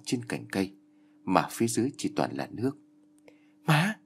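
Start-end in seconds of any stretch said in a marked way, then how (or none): 3.7-4.0 high, urgent call across the water. Acted. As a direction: shout for mom in fear. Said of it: "Sam Sam cất tiếng gọi". (tiếng gọi thất thanh)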